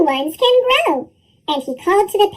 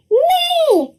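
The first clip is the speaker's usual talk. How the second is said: yelled; 11 semitones higher than usual. A narrator's reading. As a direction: shout them down. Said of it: "they all yelled".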